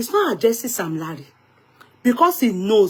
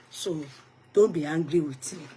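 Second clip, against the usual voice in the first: ordinary delivery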